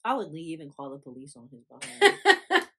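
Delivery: laughing loudly mid-sentence. Real, not acted. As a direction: laugh through the words. Laughing joke about leaving.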